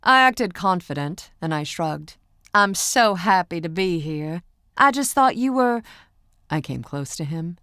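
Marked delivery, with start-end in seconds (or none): 2.5-5.8 higher, louder gushing Southern fan; playful Southern accent. Acted. Pretend fan gushing to the singer, Southern accent. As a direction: gush in a country accent. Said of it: "still speaking in that same Southern accent".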